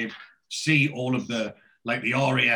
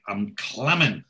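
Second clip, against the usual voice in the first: Geordie word; 3 semitones lower, slower than usual. Shown as a demonstration. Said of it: "That's a proper Geordie word".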